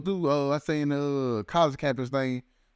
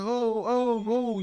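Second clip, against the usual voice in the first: higher, half-acted oh oh oh; mock panicked cries; 7 semitones higher, much slower than usual. Shot woman seeing her blood, higher mock panicked cries. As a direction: play the shot woman freaking out, lightly.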